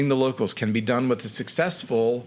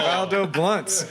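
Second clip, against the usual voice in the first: laughing; 6 semitones higher, slower than usual. Real, not acted. GM laughs repeating name.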